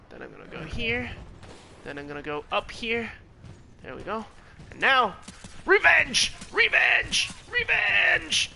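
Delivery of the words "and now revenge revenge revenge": high, loud revenge yells; battle cry. Real, not acted. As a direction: scream it as a war cry. Gamer shouts revenge.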